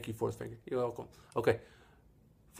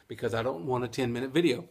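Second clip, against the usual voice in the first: quick, rattled-off patter; fast auctioneer talk; faster than usual. Real, not acted. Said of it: "try to talk like a little auctioneer".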